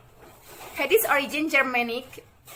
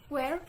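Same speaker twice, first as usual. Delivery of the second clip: "Where" in a low tone, much softer; 8 dB quieter than usual. Shown as a demonstration. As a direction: drop "Where" to a soft low tone. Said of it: "Low tone".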